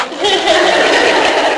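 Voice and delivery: a burst of high laughter; laughing after mimicking Jonathan's whoop. Real, not acted. Laughs at own imitation.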